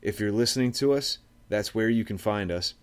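normal talking voice

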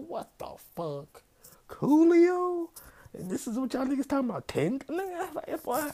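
high, loud disbelieving yell; incredulous outburst. Real, not acted. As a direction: scream the name in outraged disbelief.

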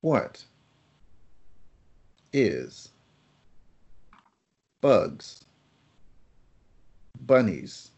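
long pauses between each word; slow quiz read, word by word; one word at a time. Shown as a demonstration. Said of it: "I will ask these questions one word at a time".

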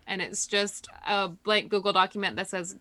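ordinary talk

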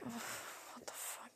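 unvoiced stunned whisper. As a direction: whisper it in disbelief. Bewildered aside to desk clerk.

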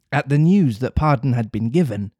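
ordinary delivery, nothing marked

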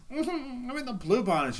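high, prissy mock voice; mock sissy. Mocking people posing in blue bonnets, in a high prissy voice. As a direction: prance it like a preening sissy.

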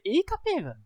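high, amazed outburst; excited exclamation. Retold or imitated. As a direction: shout it like a thrilled fan. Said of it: "eu falei". (exclamação empolgada e aguda)

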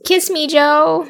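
slow, high, swooning plea; Laurie impression. Imitating Laurie begging Jo for a kiss, slow and high. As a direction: beg dreamily for a kiss. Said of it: "That's my impression of Laurie".

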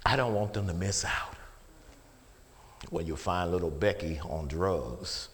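usual speaking voice

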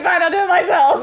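high, loud, huffy quote; mock exasperation. Teasing the co-host as Boromir, mock exasperated. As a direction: throw it out in exaggerated resignation.

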